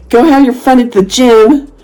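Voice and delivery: high, slow mock cheering call; obnoxious taunt. Heckling the couple leaving the restaurant, obnoxiously. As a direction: stretch out a sing-out send-off to needle them. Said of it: "It's just being obnoxious".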